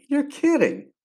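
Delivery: high, stunned squeak of disbelief; disbelieving. Retold or imitated. A caller says he won, you gasp you're kidding. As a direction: sound floored by the news. Stunned reply to winning prize.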